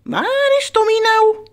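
high, loud, stretched-out call; singsong pet-owner call; playful searching call. Pretend. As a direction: draw out the first word like hide-and-seek. Calling the hidden pet bird.

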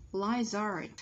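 "Lizard" as "likes art" run into one word; linked sounds. Shown as a demonstration. Teacher demonstrating linking to students, "Lizard" with no gap. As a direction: join "Lizard" smoothly, final consonant into the vowel. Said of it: "Phụ âm kết thúc của từ đằng trước nối".

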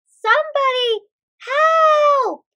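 high, loud, drawn-out shout; cry for help. Acted. Someone outside the masjid calls for help, shouting. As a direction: yell out for someone to rescue you.

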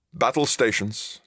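high, tense command; gritted order. A narrator's reading. Captain calls crew to battle stations, gritted. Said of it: "Tarvin gritted".

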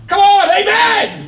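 high, loud, drawn-out call to the congregation; rally the room for an amen; preacher's loud, slow, high call for an amen; rousing call for amen. Real, not acted.

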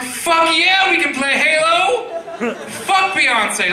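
mock-shout like a gamer who just got the best offer; thrilled yell. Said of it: "I'm like".

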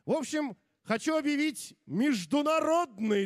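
ringmaster. Acted. MC winds up to announce.

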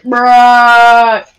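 one word held out loudly, level pitch; long loud bruh. Retold or imitated. Kid replying to the pizza guy, long loud bruh. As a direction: drag it out as a loud mock comeback. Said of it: "delivery guy was like".

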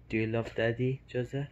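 ordinary talk

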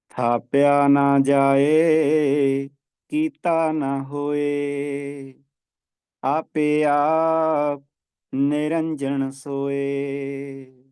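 drawn-out sacred chant, every word held long; slow devotional recitation. Acted. Teacher reciting the pauri for students, slow devotional chant. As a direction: intone the verse slowly and reverently.

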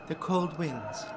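put-on very soft French accent. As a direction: speak softly with a French accent. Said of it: "still good at my French accent".

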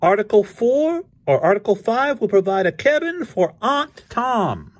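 very high, loud proclaiming cat; Krazy Kat. A narrator's reading. Krazy proposes articles to Ignatz, high proclaiming voice. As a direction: announce it grandly way up high.

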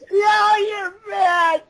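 slow, high, laughing yell; laughing shout. Real, not acted. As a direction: howl it out in disbelief. Reacting to the punchline.

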